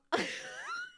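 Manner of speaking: high-pitched burst of real laughter; breathless high giggle. Real, not acted. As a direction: squeal with laughter. Cracking up at the lyric.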